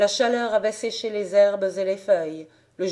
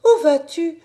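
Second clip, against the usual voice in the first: pleading cry; 9 semitones higher, 7 dB louder than usual. A narrator's reading. Little sister begging sister stay.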